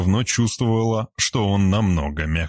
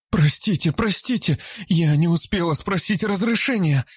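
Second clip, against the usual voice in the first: much higher, panicked begging; frightened pleading; 11 semitones higher than usual. Acted. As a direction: beg forgiveness in a fright. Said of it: "испуганно запричитала".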